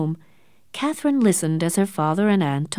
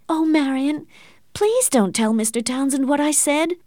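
high, anxious plea; begging; 7 semitones higher than usual. A narrator's reading. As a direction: plead in a raised pitch. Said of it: "Catherine begged".

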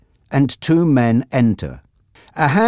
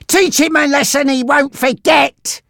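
high-pitched, furious order; angry shout; 3 dB louder than usual. A narrator's reading. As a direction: goad the henchman on angrily. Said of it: "Frankie shouted angrily".

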